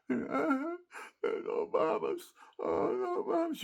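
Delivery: high, slow mock wail of pleading villagers; imitating crying villagers. Veteran voicing crying villagers begging his unit, high wailing plea. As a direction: cry it out like a terrified family begging the bombers. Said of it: "And they're all crying".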